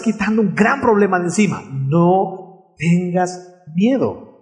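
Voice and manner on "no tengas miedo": drawn out, very slow and gentle; slow tender reassurance. Shown as a demonstration. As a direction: let each word settle like a promise. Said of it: "quiero decirte".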